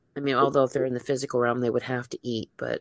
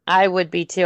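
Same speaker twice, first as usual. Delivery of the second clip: ordinary talk, nothing marked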